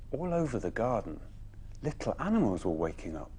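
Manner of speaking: ordinary delivery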